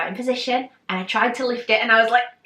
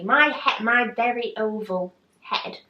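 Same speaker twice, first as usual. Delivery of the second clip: ordinary talk, nothing marked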